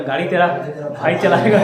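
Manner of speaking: loud, cocky retold boast breaking into laughter; laughing boast. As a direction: crack up while quoting the swagger. Jokey boast retold in class. (हँसते हुए ज़ोर से शेखी)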